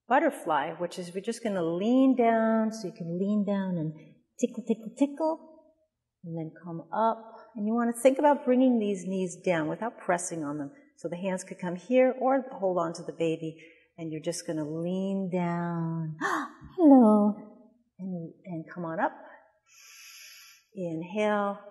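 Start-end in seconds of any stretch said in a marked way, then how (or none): 4.4-5.4 playful tickle voice. Acted. Tickling baby during yoga. 16.8-17.4 surprised gasp, then a bright louder hello; mock gasp at baby. Mother greeting her baby with a mock gasp, playful.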